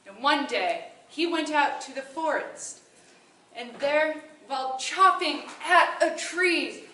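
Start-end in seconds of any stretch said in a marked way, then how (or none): none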